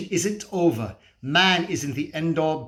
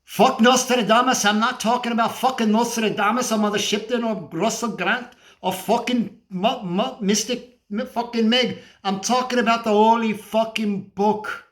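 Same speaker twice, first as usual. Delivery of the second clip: higher, heated, swearing outburst; indignant correction with stumbles on the name; exasperated rant; 6 semitones higher than usual. Acted. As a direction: burst out, brushing off the wrong idea.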